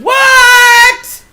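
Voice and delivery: high, loud shocked yelp; shocked what. Real, not acted. Reacting to the daughter asking her mom, a loud shocked what. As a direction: shoot up in disbelief.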